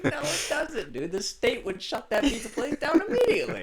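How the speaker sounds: high, laughing mock protest; laughing retort. Retelling the argument with the cop, laughing. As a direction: laugh through the comeback. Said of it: "I was like".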